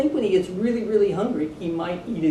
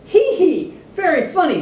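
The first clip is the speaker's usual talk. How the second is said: high, loud mocking giggle; mocking laugh; 8 semitones higher, 6 dB louder than usual. A narrator's reading. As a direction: snicker at the lion in his underwear. Elephant laughing at the lion.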